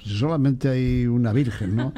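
normal talking voice

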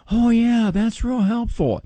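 high, loud put-on enthusiasm; mock appreciation. Host imitates a caller reacting to his advice, mock appreciation. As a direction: gush it like a caller playing impressed.

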